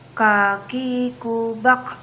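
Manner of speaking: slow, breathy kaki kubak with air let out on the k; slow recitation, audible airflow on the k; breath flowing out. Shown as a demonstration. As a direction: stretch "Kaki kubak" out slowly, letting air flow after each k. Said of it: "Perhatikan ada nafas atau udara yang mengalir".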